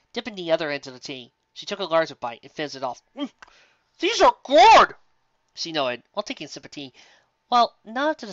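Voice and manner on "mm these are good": high, loud burst of delight; delighted exclaim. Acted. Twilight praising the baker's biscuits, high loud delight. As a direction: gush over the biscuit.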